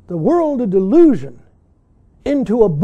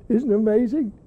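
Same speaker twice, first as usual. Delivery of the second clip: ordinary talk, nothing marked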